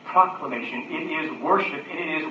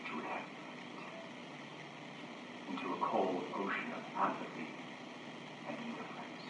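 low, soft pastoral appeal; hushed, searching preaching; 4 semitones lower, 7 dB quieter than usual. Acted. Preacher asks the congregation about cold souls, hushed preaching. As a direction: ask it quietly, with long pauses.

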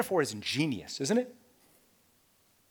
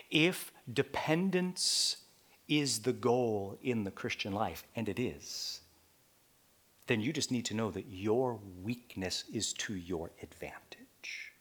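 softer, whispered line ending in "your weakness is to your advantage"; hushed first take; 6 semitones lower, 6 dB quieter than usual. Shown as a demonstration. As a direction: say it low and intimate. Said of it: "I'm gonna say that again".

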